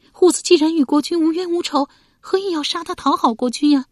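high, loud protest; high-pitched indignant pleading; distraught wife. A narrator's reading. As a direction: challenge him desperately for your son. Wife argues for son's life.

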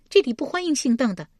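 high, fast unwelcoming woman; displeased. A narrator's reading. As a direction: refuse them quickly in a high voice, displeased. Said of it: "顿时沉下脸道". (沉下脸，高声快速地拒绝)

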